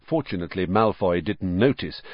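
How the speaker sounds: ordinary delivery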